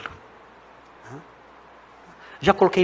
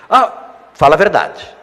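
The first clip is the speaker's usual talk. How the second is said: loud challenge; 2 semitones higher, 8 dB louder, slower than usual. Retold or imitated. Retold exchange with book seller.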